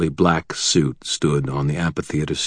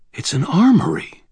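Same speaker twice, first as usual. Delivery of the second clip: alarmed realization. Acted. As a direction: voice jumps up in alarm on armory. Realizing the vault is an armory.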